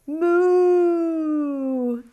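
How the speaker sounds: big, high pretend cow moo; loudest moo. Teacher leading the class's loudest moo, a big cow call. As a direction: give it your biggest cow moo. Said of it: "here's the loudest one yet".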